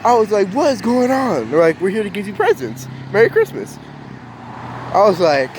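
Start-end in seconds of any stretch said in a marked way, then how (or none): 0.5-1.5 high-pitched re-enacted disbelief; bewildered. Bewildered child at the door. 1.8-3.8 quick, high festive greeting; cheerful announcement. Retold or imitated. Firefighters cheerfully bringing gifts.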